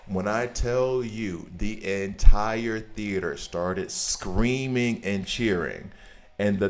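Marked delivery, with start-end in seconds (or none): 0.1-5.3 slow, drawn-out and louder for emphasis; slow emphatic storyteller build. Real, not acted. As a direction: stretch it out to sell the moment. Emphatic build about theater screaming.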